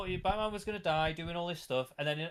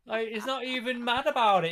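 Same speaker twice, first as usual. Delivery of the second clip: ordinary delivery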